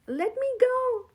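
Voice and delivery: high, loud cry for help; a small voice yelling in distress; desperate little yell. A narrator's reading. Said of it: "yelled the voice".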